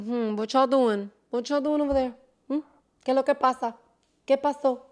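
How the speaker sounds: softly voiced nosy neighbour, a touch hushed; quietly prying into others' business; nosy gossip. Imitating a nosy relative asking what's happening, softly. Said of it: "Like that".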